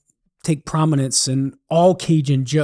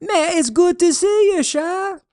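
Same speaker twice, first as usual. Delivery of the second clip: much higher Cajun call, friendly; Cajun holler. Acted. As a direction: shout the greeting in a high Cajun voice. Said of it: "Thibodeau hollers back".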